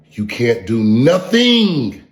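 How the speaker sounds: drawn out, every word leaned on; slow, heavy put-down; slow emphasis. Real, not acted. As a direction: stretch it out to rub it in. Stretched insult.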